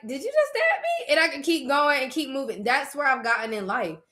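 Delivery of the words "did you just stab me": very high, squeaky mock question; mock disbelief. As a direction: ask it in a tiny high voice. Acts out unfazed stab reaction.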